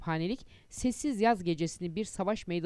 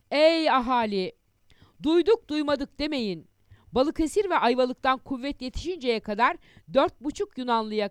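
town crier; 5 semitones higher, 4 dB louder than usual. Acted. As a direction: call it out to the whole street. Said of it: "Bağırdı durdu".

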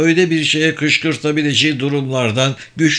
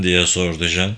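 plain reading voice

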